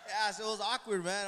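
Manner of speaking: loud laugh through the words. Real, not acted. Laughing as he tells the church it was awkward. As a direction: burst out laughing at it.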